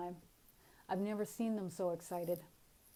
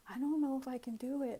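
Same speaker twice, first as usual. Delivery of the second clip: higher, laughing nervous protest; half-laugh it, doubting yourself; lightly acted self-doubt; 6 semitones higher than usual. Acts out doubt to archangel.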